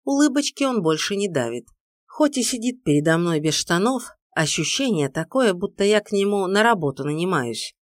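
usual speaking voice

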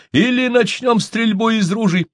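high-pitched warning call; shouted threat. A narrator's reading. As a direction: call the threat out across a distance. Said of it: "крикнул он".

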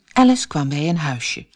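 ordinary delivery, nothing marked

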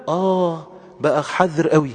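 ordinary delivery

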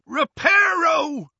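high, loud, drawn-out incantation; long ringing battle cry; shouted spell. A narrator's reading. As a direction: shout the spell slowly at full force.

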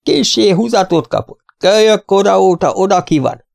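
put-on high-pitched, stubborn old fellow; old man. A narrator's reading. Old man stubbornly repeats himself. (magas, makacs öregember hangján)

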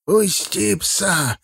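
put-on old man's slow, high command; hoarse, strained order. A narrator's reading. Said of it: "с хриплым надрывом приказал старик".

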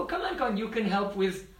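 high, eager retold beckoning; acted motherly call to come and help; calling over. Mother calls gopis over to help.

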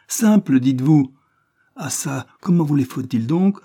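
high, loud incredulous echo; outraged retort. A narrator's reading. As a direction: throw his word back in outrage. Cornélie outraged at his answer. (réplique outrée)

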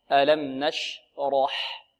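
نشرح very slow with thick ra; careful slow model of نشرح; heavy raa, light haa. Shown as a demonstration. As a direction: stretch نشرح, dark r then thin ح. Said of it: "والحاء مرققة".

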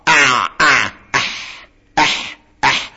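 squeezed أع, then soft breathy unvoiced أح; ayn and haa demo. Shown as a demonstration. Teacher shows middle-throat letters, ayn and haa demo. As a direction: isolate each ع and ح at mid-throat. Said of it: "العين والحاء".

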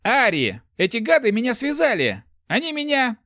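high, loud frantic pleading; frantic complaint. A narrator's reading. Tied-up thief complaining to Ari, frantic and loud.

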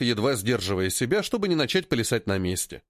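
plain reading voice

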